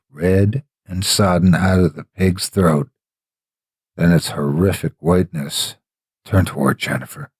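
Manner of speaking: plain reading voice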